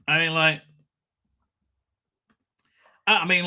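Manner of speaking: ordinary delivery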